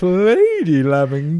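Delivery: posh cartoon lady. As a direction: present her grandly as a cake mascot. Mascot voice continues the bit.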